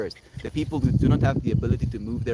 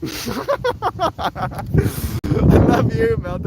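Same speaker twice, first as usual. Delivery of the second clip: gushing; 11 semitones higher, 4 dB louder, much slower than usual. Real, not acted. Affectionate to Melt.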